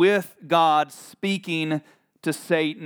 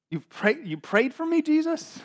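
high, incredulous question; small, high-pitched comic bewilderment; mock disbelief; 10 semitones higher than usual. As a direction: rise higher as if baffled. Acted incredulous Peter reply.

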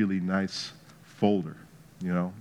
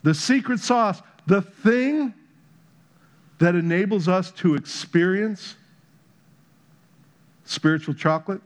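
higher, building fervent sermon; rising preacher's fire; preaching; 7 semitones higher than usual. Acted. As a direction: drive the point home from the pulpit. Preaching climax.

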